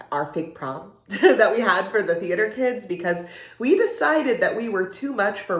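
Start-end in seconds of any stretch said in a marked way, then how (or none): none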